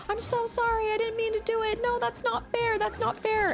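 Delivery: put-on high whiny pleading child; tantrum kid. Mom imitating a boy losing his mind over a game, high whine. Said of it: "he lost his mind".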